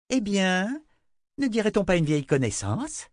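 high, mocking simper. A narrator's reading. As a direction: coo it sweetly, full of scorn. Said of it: "minauda Violette".

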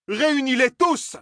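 very high slow shout. A narrator's reading. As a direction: bellow the order slowly. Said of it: "cria Fane".

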